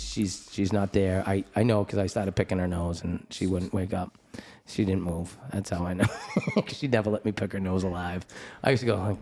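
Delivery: usual speaking voice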